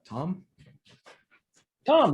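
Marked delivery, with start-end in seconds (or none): 1.8-2.1 high, loud shout of a name; playful call. Pretend. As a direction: call out suddenly, much higher. Calling out for someone named Tom.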